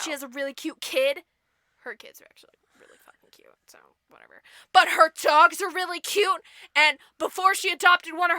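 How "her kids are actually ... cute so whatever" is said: whisper. Real, not acted. Rapid unvoiced aside.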